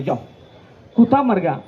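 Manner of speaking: normal talking voice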